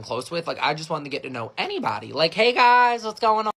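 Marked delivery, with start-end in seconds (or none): 2.3-3.5 high, loud eager hello; eager greeting. Acted. Kid wanting to get to know anybody, eager greeting. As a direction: call out brightly to the whole group.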